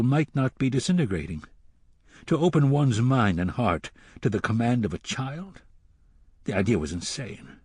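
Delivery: plain reading voice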